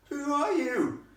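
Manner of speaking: high, loud demand; high-pitched imperious question; demanding girl. Acted. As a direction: demand it sharply as a posh girl. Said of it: "demanded Stella".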